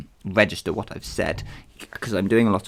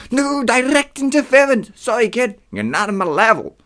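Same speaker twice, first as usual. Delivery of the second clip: put-on high, loud accented god voice; Ares; 13 semitones higher, 7 dB louder than usual. Ares turning down a kid, loud put-on accent. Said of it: "that was Ares, so I changed the accent".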